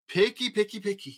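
complaining. Acted. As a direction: grumble "picky, picky, picky" like a put-out monster. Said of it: "complained Herbert".

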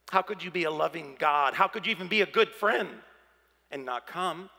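ordinary delivery

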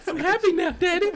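high, loud imitation of a creepy little kid; teasing high-pitched kid impression; mock happy child. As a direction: pipe it up with fake cheer. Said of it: "smiling face as she's still upset crying".

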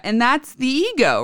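ordinary talk

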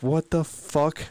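retold disbelief at bad news; incredulous. Owner hearing the vet's list of ailments, incredulous.

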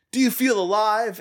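high-pitched mock confrontation; retold scream. Retelling a terrible movie face-off, high mock yell. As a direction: imitate a yell at only modest volume. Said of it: "he just screams in his face".

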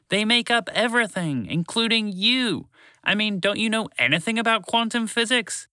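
high-pitched, exasperated lecturing; frustrated. A narrator's reading. Shala berating Walter over quantum physics, frustrated. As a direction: press the point in high exasperation. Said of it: "shouted in frustration".

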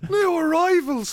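high, slow, laughing mock repeat. Real, not acted. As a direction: crack up while echoing the phrase. Mocking friend's mistake, laughing.

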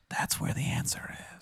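whispered, very soft; inner voice whisper. Acted. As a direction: whisper it like a thought in the back of the head. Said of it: "some part of you in the back of your head is like".

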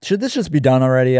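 usual speaking voice

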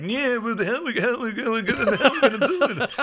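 a Vin Diesel that comes out like Goofy; Vin Diesel impression. Mocking Vin Diesel for the heist movie, goofy cartoon voice. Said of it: "Is Vin Diesel goofy? ... that sounded more like Goofy".

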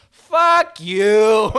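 put-on loud, high Boston-accented heckle; bad Boston accent. Heckling a comic at an open mic, shouted bad Boston accent. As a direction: yell it in a hammed-up Boston accent. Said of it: "put on my worst Boston accent".

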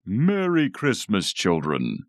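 high, slow, drawn-out Santa call; stretched-out festive proclamation; jolly Santa greeting. A narrator's reading. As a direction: greet the children as a hammy Father Christmas. Dad playing Santa greets the children.

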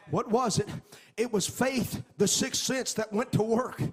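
loud, high, sing-song preaching cadence; full-force preaching. Acted. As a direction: preach it out, rising and ringing over the music. Preaching climax over music.

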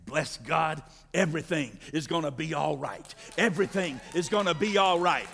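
a hint of a grandfather's calm; granddaddy's saying. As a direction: voice an old grandfather comforting. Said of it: "My granddaddy used to say it like this".

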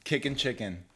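normal talking voice

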